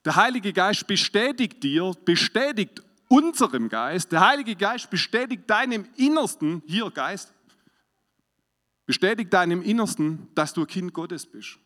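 loud emphatic sermon delivery; preaching. Acted. Preacher explaining the verse to the church, loud preaching. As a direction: drive the verse home to the congregation.

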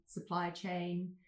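ordinary delivery

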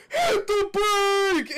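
drawn-out, very high, loud yell; hammed-up stretched shout; imitated screaming. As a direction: scream it slowly like a frantic warning. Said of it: "like, he's screaming".

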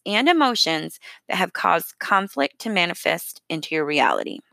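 usual speaking voice

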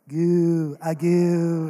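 put-on playful goo sounds to a baby; baby cooing. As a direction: coo at the baby. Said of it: "me going".

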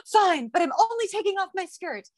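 high-pitched huffy giving in; grudging concession. Retold or imitated. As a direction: concede with theatrical exasperation.

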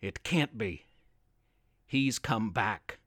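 a quiet, frightened realisation; fearful dread. A narrator's reading. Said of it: "now grew quite fearful".

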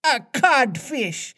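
very high, drawn-out insult; squeaked-up mimic of a pirate; mocking voice. A narrator's reading. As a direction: taunt him high and slow. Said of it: "replied the".

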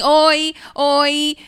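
loud mock greeting. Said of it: "chegam assim".

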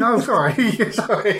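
high, laughing sorry; cracking up at your own slip; laughing apology. Real, not acted. Corrects a wrong actor's name, giggling.

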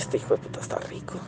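breathy whisper of desire; whispered thought. Retold or imitated. Thinking about the attractive new coworker, whispered.

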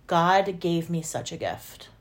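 ordinary delivery

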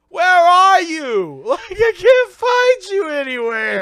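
high, loud calling out; drawn-out, high-pitched mock calling; acted searching shout. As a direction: holler for someone you can't find. Acting out a woman searching for Mike.

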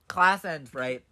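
ordinary talk, nothing marked

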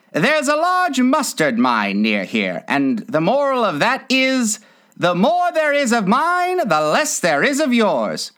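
high-pitched, agreeable Duchess; moralizing Duchess. Acted.

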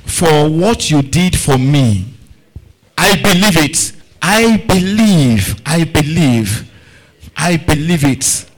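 slow, drawn-out, forceful prayer; fervent declaration. Real, not acted. Preacher leads congregation in thanks, declaring fervently. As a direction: declare each line with full conviction.

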